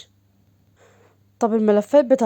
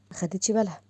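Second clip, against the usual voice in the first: ordinary talk, nothing marked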